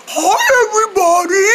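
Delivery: high squeaky toy monkey voice. Pretend. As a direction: voice the stuffed monkey, high and bright. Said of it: "Say hi, monkey".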